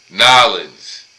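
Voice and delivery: imitated New Orleans accent, "Nolens" run together, loud and falling; "Nolens" as two syllables in New Orleans accent; thick New Orleans accent. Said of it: "her accent was thick as fuck".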